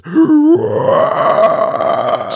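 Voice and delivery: high whispered demonic screech, imitating the monster; demonic wailing. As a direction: let out a rasping, unearthly wail at full breath. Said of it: "this demonic wailing noise".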